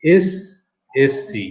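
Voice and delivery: এসএসসি very slowly, "es... es-si"; spelled-out, drawn-out এসএসসি for learners; slow correct pronunciation. Shown as a demonstration. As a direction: break up এসএসসি with pauses and pure e. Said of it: "উচ্চারণটা হচ্ছে এসএসসি".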